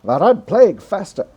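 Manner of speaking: booming American radio announcer. Pretend. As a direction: read it like an old-time American radio host. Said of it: "that's my American radio voice".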